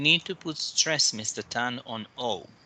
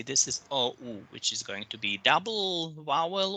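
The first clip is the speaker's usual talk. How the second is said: ordinary talk, nothing marked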